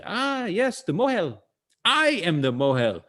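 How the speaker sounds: a hint of a knowing old shopkeeper; old man in a joke.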